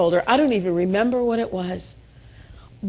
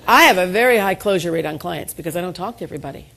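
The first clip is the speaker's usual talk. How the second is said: usual speaking voice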